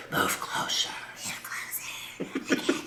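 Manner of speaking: unvoiced hushed whisper. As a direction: whisper close to the ear. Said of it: "she was like".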